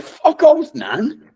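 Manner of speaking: a sudden high, loud jab; mock yell. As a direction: fling it back at nan in mock outrage. Mock retort to nan's comment.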